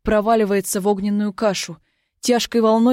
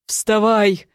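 barked order, louder; harsh command; 3 dB louder than usual. A narrator's reading. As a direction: snap it out as an order. Princess repeats order.

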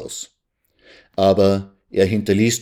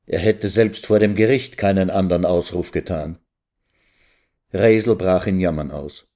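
plain reading voice

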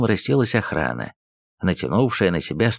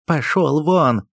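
higher slow angry order; barking; 6 semitones higher, slower than usual. Acted. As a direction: throw him out with a bark. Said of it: "рявкнул на Ерофея приказчик".

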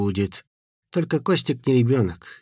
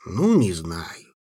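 slow, drawn-out hesitant; 5 semitones lower, slower than usual. A narrator's reading. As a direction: drag the words out unsure. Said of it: "протянул Санька".

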